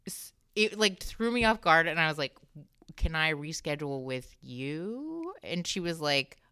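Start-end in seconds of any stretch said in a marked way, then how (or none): 3.0-5.3 slow, careful question with rising end; drawn-out, deliberate retold request; pointed request. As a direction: ask it with exaggerated patience. Pointed request to doctor posing as receptionist.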